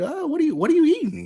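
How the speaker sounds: high mock impression of a chatty stranger; imitating the young lady. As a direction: squeak it out like the woman at lunch. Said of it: "That's how she sounded?".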